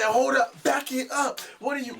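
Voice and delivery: high and loud, stunned disbelief; shocked hold up. Retold or imitated. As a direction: stop her short, taken aback. Retelling his shocked reaction.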